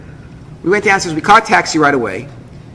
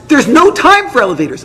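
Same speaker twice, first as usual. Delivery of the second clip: urgent; 7 semitones higher, 3 dB louder than usual. Retold or imitated. Wife urges husband to hurry.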